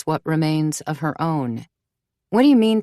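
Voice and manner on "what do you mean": urgent. A narrator's reading. Mother presses urgently.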